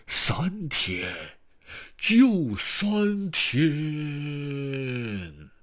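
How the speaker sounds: grave spectral voice, rising to an eerie wail; haunting uncle's ultimatum; ghost's warning. A narrator's reading. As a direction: warn ominously, stretching the last word out long. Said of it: "鬼魂用低沉的声音问道".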